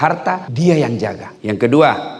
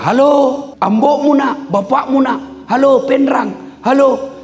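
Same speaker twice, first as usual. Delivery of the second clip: comic yelling; 13 semitones higher, slower than usual. Acted. Rich old man yelling for his child on a phone, high comic shout. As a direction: holler down the line as if it were broken.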